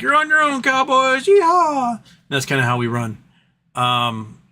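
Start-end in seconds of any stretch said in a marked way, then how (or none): 0.0-2.0 high-pitched, hammed-up cowboy holler; whooping cowboy. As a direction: send them off with a mock yeehaw, voice way up high. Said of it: "the U S is like".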